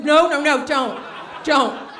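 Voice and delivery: loud, urgent no; mock panicked begging; pleading cry. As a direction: stop him before he says it. Begging him not to name song.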